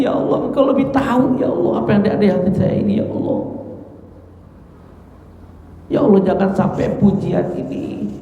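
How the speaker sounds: fearful pleading prayer. Retold or imitated. Abu Bakar pleading with God, afraid of praise, high and strained. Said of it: "malah akan takut Abu Bakar".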